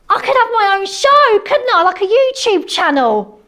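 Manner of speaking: high, loud and thrilled; gushing over her own brilliant plan; excited. Real, not acted. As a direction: squeal with the thrill of the idea. Excited by her new idea.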